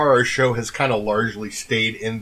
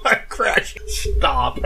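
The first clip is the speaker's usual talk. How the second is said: laughing; 11 semitones higher, slower than usual. Real, not acted. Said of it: "I just brought that to a crashing fall".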